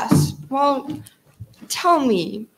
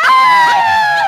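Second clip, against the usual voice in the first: high loud yelping howl; 9 dB louder than usual. Acted. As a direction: let out a wild howl. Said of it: "sing, sing".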